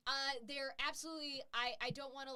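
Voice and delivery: normal talking voice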